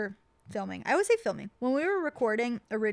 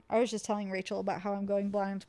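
ordinary talk

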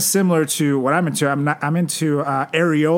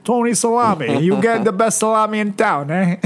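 higher, loud Italian pizza guy; pizza man voice; 6 semitones higher, 3 dB louder than usual. Acted. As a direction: hawk it like a pizza parlor owner. Voicing Tony the pizza man.

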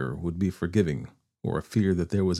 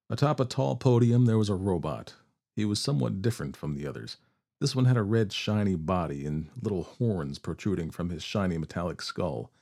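plain reading voice

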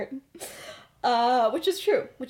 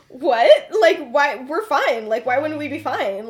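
loud, raised-pitch mock protest; defensive denial; 3 semitones higher, 7 dB louder, slower than usual. Remus insisting to James that nothing is wrong, defensive. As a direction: blurt it out, imitating someone caught off guard.

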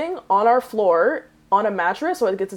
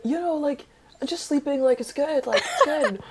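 higher, soft imitation of a guy playing innocent; feigned nonchalance; 6 semitones higher, 4 dB quieter than usual. Intruder answering the roommate at five AM, feigned nonchalance. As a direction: brush it off softly, up high.

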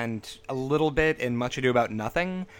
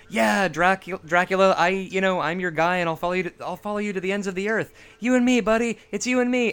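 earnest, devoted sidekick voice, pitched higher and climbing; eager Harker; 6 semitones higher, 3 dB louder than usual. Retold or imitated. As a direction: gush loyalty to your master. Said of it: "and he's just like".

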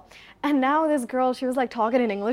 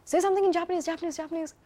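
brother's nagging; 7 semitones higher, much faster than usual. Retold or imitated. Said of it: "my bhaiya is there".

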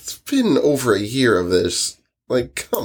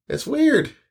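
high incredulous. Real, not acted. Reacting to reboot cursing.

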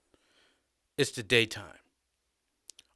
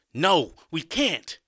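firm refusal; 5 semitones higher, 7 dB louder, slower than usual. A narrator's reading. Hero refuses to kill.

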